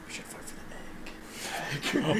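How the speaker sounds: whispering. Real, not acted. Conspiratorial suggestion.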